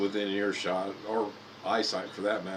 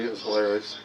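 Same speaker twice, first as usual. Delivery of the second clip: normal talking voice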